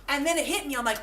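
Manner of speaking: usual speaking voice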